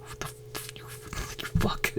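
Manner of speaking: under-breath angry whisper; muttering under his breath at the villain; whispered outrage. Real, not acted. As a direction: hiss the insult at the screen. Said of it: "still pisses me off".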